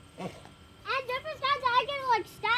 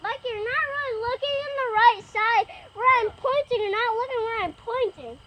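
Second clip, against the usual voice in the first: louder frustrated call to Mikey; calling from far away; 4 semitones higher, 7 dB louder than usual. Real, not acted. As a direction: call out, a little exasperated.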